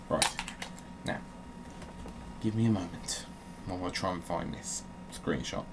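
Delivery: usual speaking voice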